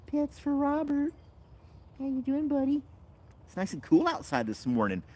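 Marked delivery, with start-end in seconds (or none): 0.1-2.8 pet talk. Real, not acted. Owner petting her dog in baby talk.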